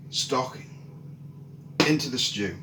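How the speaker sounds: plain reading voice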